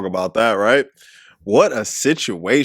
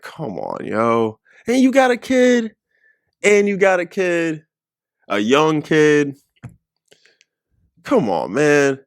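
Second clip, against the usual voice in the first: put-on imagined disbelieving rant, pitch jumping high on the kid; mock outrage; 4 semitones higher, slower than usual. Viewer imagining dating a married woman, outraged disbelief. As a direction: voice what you'd say to a married date, incredulous. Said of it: "I'd be like".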